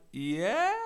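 hesitant reply to a stranger; a bit hesitant. Retold or imitated. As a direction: answer warily, rising into a question. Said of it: "a bit hesitant".

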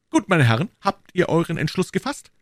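high-pitched challenge; calling out. A narrator's reading. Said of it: "rief Jussac zum dritten Mal".

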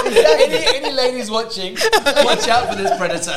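loud, laughing through the words; giggling mock warning. Real, not acted. Teasing warning amid laughter.

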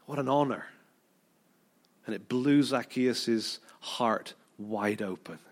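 ordinary delivery

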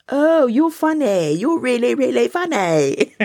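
very high, drawn-out mock amusement; slow sing-song teasing squeal; teasing disbelief. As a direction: stretch it out high, as if humouring a joker. Teasing reply to God, acted.

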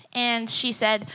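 usual speaking voice

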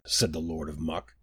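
plain reading voice

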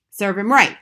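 loud, curt dismissal; unsympathetic verdict. A narrator's reading. Wife on boy with glued finger, unsympathetic. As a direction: cut in loudly with no sympathy.

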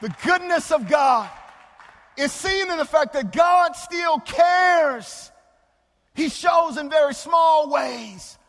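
slow, loud pulpit build; swelling sermon proclamation; preaching. Acted. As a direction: preach it out with full force. Preacher at full force.

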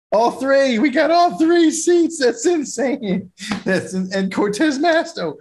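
squealing with joy at the news; overjoyed. Real, not acted. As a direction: burst out in real delight at the win. Overjoyed at election results.

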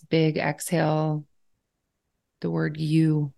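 calm guiding voice with long, unhurried pauses; guided meditation. Real, not acted. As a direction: ease the listener into stillness, leaving space between phrases. Leads a gratitude meditation.

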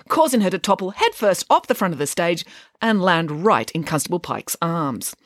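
ordinary delivery

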